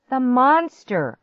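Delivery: high, loud, drawn-out exclamation; yell. A narrator's reading. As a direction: yell the answer urgently. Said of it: "I yelled".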